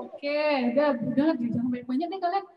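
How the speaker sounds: ordinary delivery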